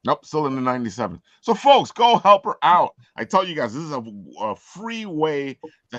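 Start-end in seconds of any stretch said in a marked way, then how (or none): 1.4-3.0 excited rally. Real, not acted. Host rallying viewers.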